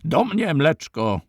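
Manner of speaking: captured man calling his friends to help. A narrator's reading. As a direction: cry out to his men across the yard. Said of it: "Ten zaś wołać począł".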